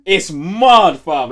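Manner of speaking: loud burst of hype; excited. Real, not acted. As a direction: belt it out, all hyped up. Hyping up a TV show.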